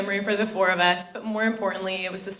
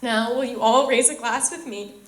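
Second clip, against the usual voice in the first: teary; 4 semitones higher than usual. Real, not acted. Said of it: "I said I wouldn't do this".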